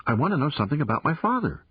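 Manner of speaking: put-on fast, eager demand from a girl; insistent young girl. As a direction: press him quickly, wanting to know.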